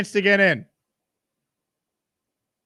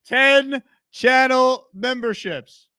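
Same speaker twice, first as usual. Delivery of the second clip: slow drawn-out plug; 7 semitones higher, 4 dB louder, much slower than usual. Real, not acted. Host drawls a pitch for memberships.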